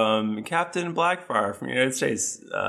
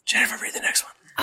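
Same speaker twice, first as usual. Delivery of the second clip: whisper; 13 semitones higher than usual. Real, not acted. Whispered aside during reading.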